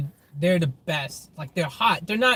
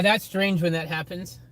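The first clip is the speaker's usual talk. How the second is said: normal talking voice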